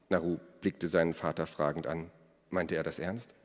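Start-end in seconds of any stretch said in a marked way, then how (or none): none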